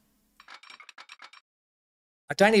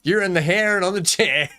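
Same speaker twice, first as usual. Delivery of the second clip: usual speaking voice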